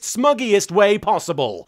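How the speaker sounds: usual speaking voice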